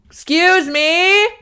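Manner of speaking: high, loud, slow excuse me; loud sing-song plea. Retold or imitated. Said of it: "That same cadence, just louder and louder".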